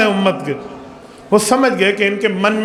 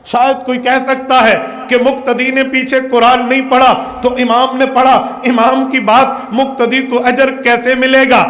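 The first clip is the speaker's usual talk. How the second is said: impassioned preacher in full flow; full-force preaching; 4 semitones higher than usual. Real, not acted. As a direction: drive each point home to the congregation.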